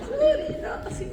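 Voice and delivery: high, teary laughing sorry; laughing through tears. Real, not acted. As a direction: laugh it off while crying. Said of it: "mababa lang yung luha ko". (tumatawang umiiyak)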